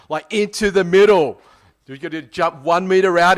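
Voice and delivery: urgent, emphatic retold briefing; insistent instructor. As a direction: imitate a guide drilling safety instructions before a jump. Retold instructor briefs nervous jumper.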